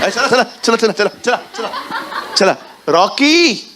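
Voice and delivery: high, insistent nagging; mother hurrying child. Acted. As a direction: nag him to come now.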